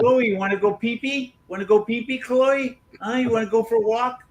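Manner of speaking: high, coaxing pet voice. Real, not acted. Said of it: "you'd fit right in at the animal shelter".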